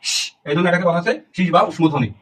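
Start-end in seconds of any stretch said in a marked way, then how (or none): 0.1-0.1 "শ" as sustained hissing air; sibilant hiss. Shown as a demonstration. Teacher explains the name of sibilants, hissed sh. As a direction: make "শ" a long shhh, no voice. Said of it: "শীষ দেওয়া থেকে".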